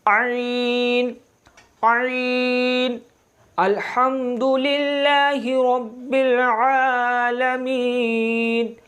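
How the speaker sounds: आइन and आलमीन drawn out slowly, ain from mid throat; recited ain demo. Shown as a demonstration. Teacher reciting Fatiha slowly to show the ain. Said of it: "हलक के दरमियान वाले हिस्से से".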